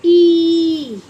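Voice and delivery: ई called out loud; stressed ई. Shown as a demonstration. Teacher calling out ई to children. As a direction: hit the ई hard. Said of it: "long vowel sound आएगा".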